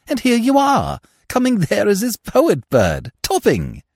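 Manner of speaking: high-pitched, eager silly-ass voice; high-voiced young fool, thrilled with the scheme; elated Freddy. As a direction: bubble over with high, excited enthusiasm.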